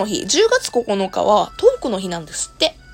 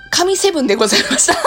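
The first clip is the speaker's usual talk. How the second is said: laughing, louder and fast; 9 semitones higher, 6 dB louder, faster than usual. Real, not acted. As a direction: announce it through a laugh.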